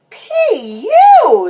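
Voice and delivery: high, loud, stretched-out disgust; exaggerated yuck reaction; disgusted exclamation. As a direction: cry it out at a stink. Disgusted at a bad smell.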